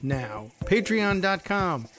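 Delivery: radio pledge-drive announcer pitch; upbeat ad read. Acted. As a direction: sell the show with a pushy smile in the voice.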